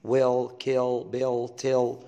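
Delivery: "Well", "kill", "bill", "tell" each ending in a heavy dark L. Shown as a demonstration. Teacher drilling students on dark L in "Well". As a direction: hold the thick L at the end of "Well". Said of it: "بيحتاج تدريب".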